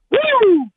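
high whooping woo; wrestler woo. Acted. Caller whoops like a wrestler on the radio, high whooping woo. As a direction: let out a big wrestling woo.

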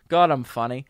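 normal talking voice

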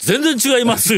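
quoting innkeeper. Retold or imitated. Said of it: "って言うたわ".

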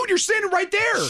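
high imitated coach pleading. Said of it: "He's like".

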